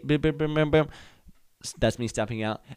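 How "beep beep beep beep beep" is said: higher-pitched run of beeps; imitated beeping. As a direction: beep your way out of the elevator. Imitates beeps while stepping out.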